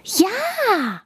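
very high enthusiastic yes; high delighted child's yes; excited yes. Acted. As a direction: cheer yes like a thrilled child. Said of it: "ruft Emi begeistert".

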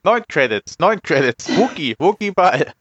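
high, pushy Jawa pidgin; squeaky trader voice, broken speech; broken Basic. Acted. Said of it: "als würde ich schlecht Basic sprechen".

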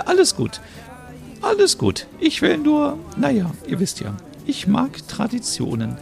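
put-on very high, thin old-lady voice; old neighbour offering help, high and frail; elderly Merit. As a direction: play a sweet old neighbour.

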